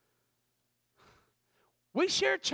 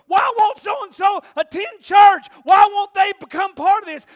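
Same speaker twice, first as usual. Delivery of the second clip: congregation's complaint shouted; 8 semitones higher, 17 dB louder than usual. Retold or imitated. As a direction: shout their puzzled question back at them. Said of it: "some of you are sitting here going".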